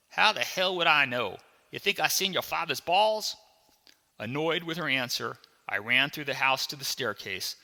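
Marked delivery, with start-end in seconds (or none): 0.1-3.4 high, loud, incredulous retort; nonplussed. Acted. Maid answers the boy's odd question, nonplussed and loud. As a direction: throw the question back in disbelief. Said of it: "and said nonplussed".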